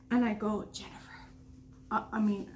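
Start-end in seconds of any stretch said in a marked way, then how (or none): 0.7-1.2 hushed whisper so the intruder won't hear. Retold or imitated. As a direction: breathe the name without voice. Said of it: "I was kinda whispering it".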